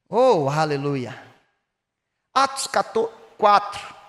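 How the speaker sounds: usual speaking voice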